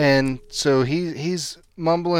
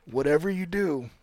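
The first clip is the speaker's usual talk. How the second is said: Jeff Brantley impression; 4 semitones lower than usual. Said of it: "my Jeff Brantley impersonation sucks".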